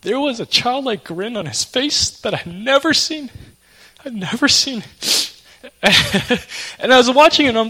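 choked up. Real, not acted. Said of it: "oh, I'm gonna cry".

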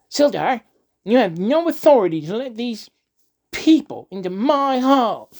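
high, loud and indignant; weaselly pompous official. A narrator's reading. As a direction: squeal it out like a pompous coward. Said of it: "when he opened his weaselly mouth".

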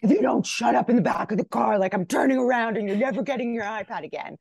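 loud, high parent's threat; flipping her lid. Retold or imitated. Mom to kids in the car, loud mock scolding. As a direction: imitate a fed-up parent yelling into the back seat. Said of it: "I flip my lid as a parent".

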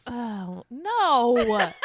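ordinary talk

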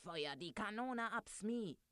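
high-pitched order, only mildly shouted; yelled command. A narrator's reading. Captain Hook orders Smee to fire the cannon, yelling. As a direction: cry out the order with a lifted voice. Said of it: "schrie Captain Hook".

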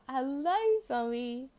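put-on high, slow baby-talk coo; cooing to a bird. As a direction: coo sweetly at the pet bird.

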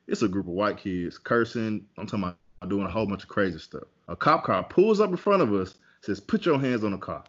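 normal talking voice

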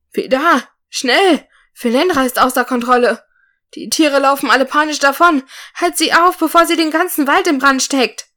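high, loud panicked shouting; dwarf shouting to the warrior that the forest is burning; urgent call. A narrator's reading. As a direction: yell the warning across the clearing. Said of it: "rief Norg ihm zu".

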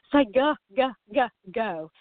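"go" repeated with a muddied uh vowel; overcued go. Shown as a demonstration. Parent over-cueing a child, "go" said with a muddied vowel. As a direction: hammer "go, go" with the vowel turned to uh. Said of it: "can't you see how we've messed it up".

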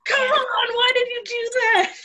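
high, loud mock outrage at the TV; loud, high-pitched exasperated complaint; exasperated yell. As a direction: yell it like a frustrated viewer at the screen. Acting out yelling at TV shows.

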